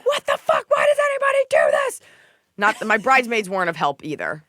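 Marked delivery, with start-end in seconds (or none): 0.1-2.0 high frustrated yell; exasperated shout. Retold or imitated. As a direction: blurt it out at the top of your voice. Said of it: "I was like".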